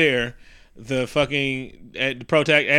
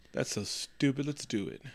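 mock resigned aside; 2 semitones lower, 12 dB quieter than usual. Cap weighing up a stupid plan, muted mock resignation. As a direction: drop the voice, quiet and offhand. Cap's deadpan reply, retold.

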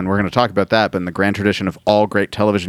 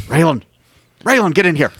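calling the dog in, raised pitch; 5 semitones higher than usual. Pretend. Friend calling his dog in from the yard, calling out. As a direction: shout for the dog to come inside.

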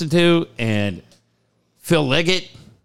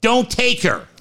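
ordinary delivery

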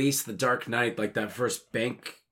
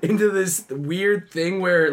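normal talking voice

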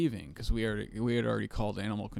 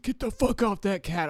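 angry yell; 9 semitones higher than usual. Retold or imitated. Neighbor yelling at his dog.

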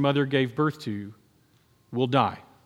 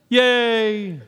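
sarcastic cheer; 5 semitones higher than usual. Acted. Ironic cheer with laugh.

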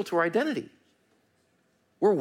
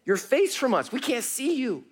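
higher, anguished plea to a hidden God; preacher voicing Israel's lament to God, pleading and higher; 7 semitones higher than usual. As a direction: voice the exiles begging God not to hide. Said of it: "they're talking to God".

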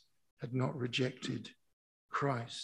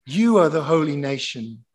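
loud proclamation, leaning long on each "you"; emphatic scripture preaching; 2 semitones higher, 9 dB louder than usual. Real, not acted. Preacher telling the church who they are, loudly proclaiming. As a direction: declare it over them with full pulpit force.

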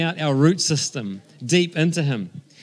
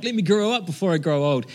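put-on American accent; 3 semitones higher than usual. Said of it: "in an American accent".

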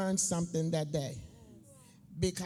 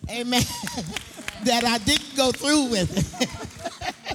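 loud grateful amen; 4 semitones higher, 4 dB louder than usual. Real, not acted. Preacher exclaims gratefully.

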